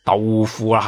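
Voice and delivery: usual speaking voice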